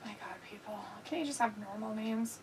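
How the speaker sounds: a hushed, fed-up aside; exasperated whisper. Real, not acted. Mock despair at a name.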